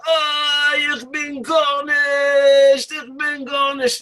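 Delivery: high, very slow drawn-out shout; yelling. Retold or imitated.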